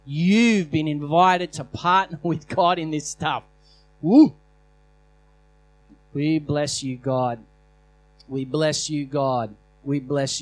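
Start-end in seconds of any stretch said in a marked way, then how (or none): 4.0-4.3 loud high whoop of joy; excited whoop. Real, not acted. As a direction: let out a joyful woo.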